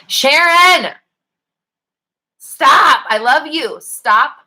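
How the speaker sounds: high, loud shouted Stop at a friend; affectionate mock scolding, loud; playful yell. Real, not acted. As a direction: yell at her to stop, half laughing. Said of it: "letting me yell at you".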